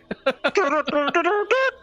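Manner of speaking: high squealing mock laugh from the back; giddy high laugh. Player in the back laughing at the jazz hands, high mock giggle. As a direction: cackle high like an overexcited bard. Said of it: "That's when you're in the back being like".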